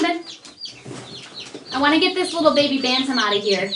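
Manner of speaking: ordinary delivery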